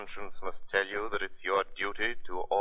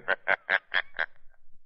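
eerie laugh. Acted. The Shadow announces himself.

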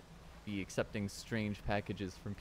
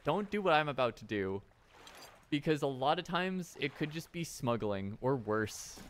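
ordinary talk

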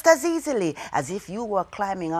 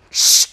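sharp shh for the lash; a hissing whip sound; imitated whip strike; whip crack; 7 dB louder than usual. A narrator's reading.

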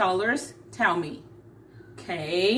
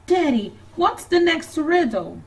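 put-on little girl; 7 semitones higher, 5 dB louder than usual. Child Annie asks Daddy.

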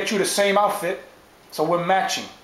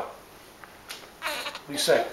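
breathy hushed whisper, slow; 9 semitones higher, 9 dB quieter, much slower than usual. Acted. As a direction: drop to a whisper and drag the question out. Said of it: "If you talk like that, then I can't hear you".

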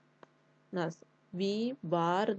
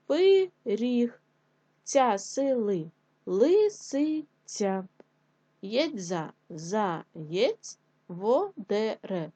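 slow sounding-out; much slower than usual. Shown as a demonstration. Teacher assembling word from syllables.

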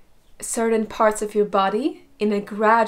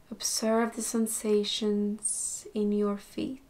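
soft, unhurried meditation voice with long pauses; guided meditation; 6 dB quieter, slower than usual. Acted. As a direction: gently guide them through a body scan, slowly and softly. Leads body scan meditation.

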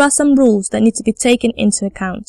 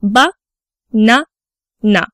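"ba, na, na" as three separate syllables with pauses; slower than usual. Shown as a demonstration. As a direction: break "banana" into ba... na... na. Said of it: "consists of three syllables".

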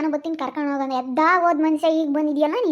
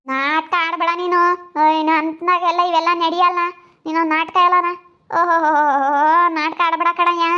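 angry scolding; 2 semitones higher, 5 dB louder than usual. Acted. Wife scolds husband for lying.

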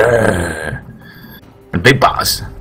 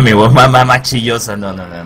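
usual speaking voice

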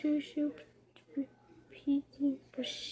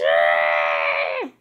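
frustrated yell; 8 dB louder than usual. Real, not acted. Angry outburst continues.

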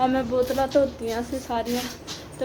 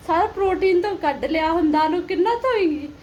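dramatic loud aunty; 6 semitones higher, 5 dB louder than usual. Retold or imitated. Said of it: "ਪਤਾ ਕੀ ਕਹਿੰਦੀ ਆ".

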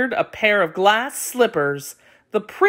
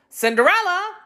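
calling out; 12 semitones higher than usual. A narrator's reading. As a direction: call her name from across the house. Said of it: "called the younger".

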